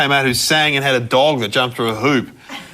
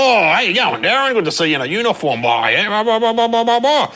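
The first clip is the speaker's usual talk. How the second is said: put-on higher, jovial voice of a dad hailing his old scout; hearty dad greeting; 8 semitones higher than usual. Dad warmly greeting his former scout, the copper, high and jovial. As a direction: play the cheery scout leader, voice up high. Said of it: "so Dad walks down and goes".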